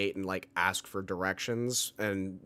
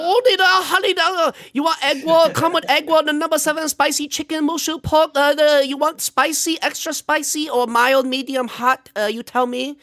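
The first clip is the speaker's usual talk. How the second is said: long hammed-up mock Chinese accent, much higher and louder; 13 dB louder than usual. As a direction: play a takeout cashier in an over-the-top Chinese accent, high and loud. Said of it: "what's that accent like?".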